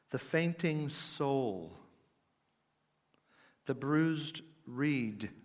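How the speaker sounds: very slow, weighty titles; solemn listing. A narrator's reading. Preacher listing Spurgeon's sermon titles, slow and weighty. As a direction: draw out each title and let it settle.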